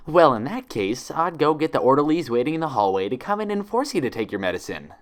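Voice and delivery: sugar-coat a threat; sweetly. Acted. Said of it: "she answered sweetly".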